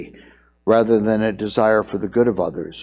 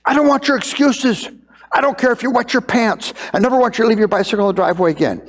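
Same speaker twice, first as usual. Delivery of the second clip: much higher, fast, exasperated outburst; furious father; 9 semitones higher, faster than usual. Retold or imitated. Pastor imitating himself cutting off his son's excuse, furious. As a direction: shut down the excuse, fed up.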